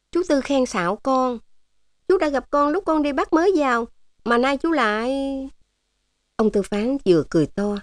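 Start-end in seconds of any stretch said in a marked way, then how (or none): 0.1-5.1 high-pitched niece voice; protesting young niece. A narrator's reading. Út Tân answering her uncle's compliment, coy protest. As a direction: protest the compliment in a higher, girlish voice.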